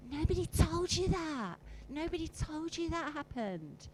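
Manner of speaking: hammed-up shocked reveal; playful gasp of surprise; mock astonishment.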